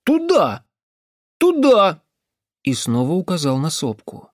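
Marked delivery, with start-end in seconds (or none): 0.0-2.0 high, loud cross cries; insistent boy. Acted. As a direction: demand it in a high bird-like shout. Boy stamps, insists on direction. (упрямый высокий выкрик)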